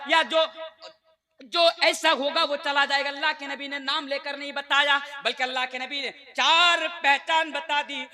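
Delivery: fervent sermon voice, rising in bursts; preaching at full force. Acted. Preacher rousing the congregation about faith, fervent preaching. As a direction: preach it to the crowd with conviction.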